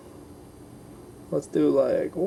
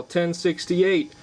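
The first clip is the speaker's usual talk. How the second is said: ordinary talk, nothing marked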